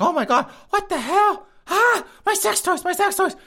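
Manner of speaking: high falsetto mock shriek of a panicked woman. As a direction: ham up a freaked-out woman finding her toys gone. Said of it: "she's like".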